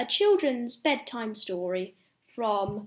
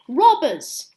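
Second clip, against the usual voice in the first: loud alarmed cry of robbers; shout; 3 semitones higher, 9 dB louder than usual. Acted. Narrator voicing the shout when robbers attack, loud cry. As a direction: call it out like a sudden warning. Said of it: "Suddenly there's a shout".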